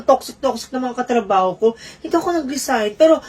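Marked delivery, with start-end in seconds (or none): none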